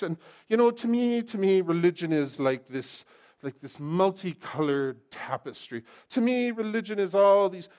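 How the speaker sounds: wishy-washy mock seeker; mocking impression. Mocking vague seekers of many options.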